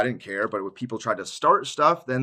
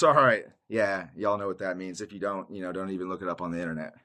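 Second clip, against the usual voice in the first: normal talking voice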